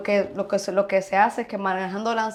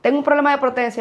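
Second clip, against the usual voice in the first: higher, louder imitated young patient; raised voice, quoting a patient; 6 semitones higher, 8 dB louder than usual. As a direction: play a patient calling on the doctor for help. Acts out young patient consulting her.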